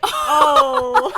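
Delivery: high, loud gasped oh; shocked gasp. Retold or imitated. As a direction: gasp it out, mock-shocked at the news. Acts out shocked reaction to reply.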